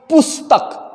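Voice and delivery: पुस्तक high, stretched to poos-tak; long u. Shown as a demonstration. Teacher demonstrates a spelling error, पुस्तक with long oo. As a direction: lengthen the u in पुस्तक to oo. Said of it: "प में बड़ी ऊ".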